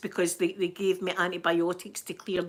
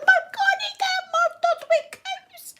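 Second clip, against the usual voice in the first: high, hammed-up panicked squeal; mock scream; 4 dB louder than usual. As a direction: squeal in mock terror at the cows. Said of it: "I'm screaming like that".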